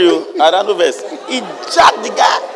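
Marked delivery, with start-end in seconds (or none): 1.3-2.5 shouted, slow "jacked the guy" grab; demonstrated shout. Shown as a demonstration. Preacher acting out the servant's attack, shouting. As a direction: bark it out like a rough attack. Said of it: "I'm demonstrating it".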